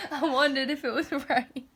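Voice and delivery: breaking into laughter; laughing. Real, not acted. Reader cracking up mid-story, laughing. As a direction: laugh through the line.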